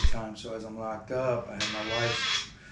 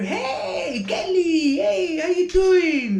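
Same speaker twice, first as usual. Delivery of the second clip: very high, loud, drawn-out holler; high-pitched, full-lunged impression, stretching the vowels; Moody's eccentric scream; 10 dB louder, much slower than usual. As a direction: screech a greeting across the chow hall. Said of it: "he knows that I'm dead on with this voice".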